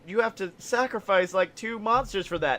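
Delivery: ordinary talk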